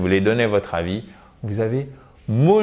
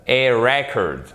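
"record" said REC-ord, front-stressed; stress on first syllable; slower than usual. Shown as a demonstration. Teacher modelling the noun after explaining it, first-syllable stress on "record". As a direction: punch REC in "record", let the rest fall. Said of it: "l'accent tonique est sur la première syllabe".

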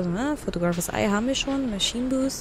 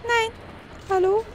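frightened; 10 semitones higher, much slower than usual. Real, not acted. Frightened while playing horror game.

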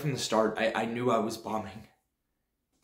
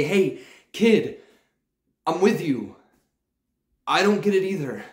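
put-on loud, higher call across the hall to the kid; called-out quote; 7 semitones higher, 7 dB louder than usual. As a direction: call out to him warmly and loudly.

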